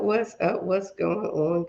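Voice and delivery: normal talking voice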